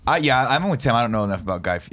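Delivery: ordinary talk, nothing marked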